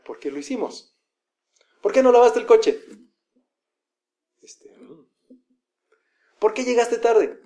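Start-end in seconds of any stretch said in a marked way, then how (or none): 1.8-2.8 high, loud accusing question; reproche. Acted. Someone demanding reasons, reproachful. 4.4-5.1 barely audible whispered hesitation; titubeo culpable. Acted. Guilty person fumbling for an answer. 6.4-7.4 slow, high accusing question; reproche. Acted. Someone demanding reasons, reproachful.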